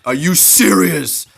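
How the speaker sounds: no, no, no, cracking high in exasperation; furious disbelief. Retold or imitated. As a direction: rage at the blizzard call. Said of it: "I'm like".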